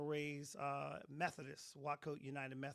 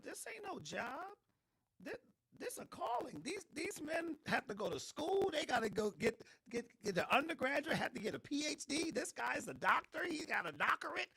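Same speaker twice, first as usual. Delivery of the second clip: exasperated; 11 semitones higher, 4 dB louder than usual. Retold or imitated. Mocking retold exasperation.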